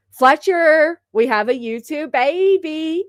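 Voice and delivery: high, loud and drawn-out cheer; excited announcement. Real, not acted. Streamer announcing a YouTube baby to Fletcher, excited. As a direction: call it out in delight, stretching the end.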